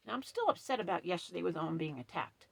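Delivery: ordinary delivery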